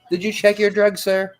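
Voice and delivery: ordinary talk, nothing marked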